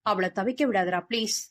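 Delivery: ordinary delivery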